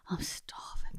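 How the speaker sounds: barely voiced whisper; whispered aside. Real, not acted. Host admitting hunger as topic ends, whispered. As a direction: whisper it under your breath.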